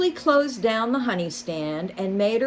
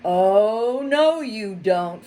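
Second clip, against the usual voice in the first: louder, slow, firm refusal; ordering; 3 semitones higher, 6 dB louder, slower than usual. A narrator's reading. Fox ordering the mole to stop, slow and firm. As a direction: lay down the law. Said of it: "Freddy ordered".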